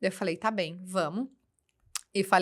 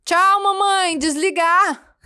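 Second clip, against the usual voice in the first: put-on high, loud toddler goodbye; 9 semitones higher, 9 dB louder, much slower than usual. Mother imitating her son ending the call. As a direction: play the little boy hanging up on mom. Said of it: "ele falou".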